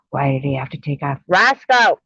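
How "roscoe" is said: loud high call for the dog. Real, not acted. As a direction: shout the dog's name into the dark. Calling his lost dog.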